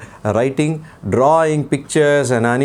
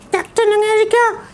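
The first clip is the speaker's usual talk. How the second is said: put-on puppet boy voice. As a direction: play the cheeky little boy puppet. Puppet names hero.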